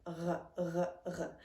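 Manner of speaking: R, r, r rasped softly at the back of the throat; French R. Shown as a demonstration. Teacher modeling the back-of-throat R. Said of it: "more in the back of your mouth".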